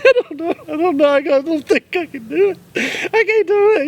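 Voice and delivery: very high mock wail; hammed-up squeaky, helpless refusal; imitated panic. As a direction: mimic someone too scared to go through with it.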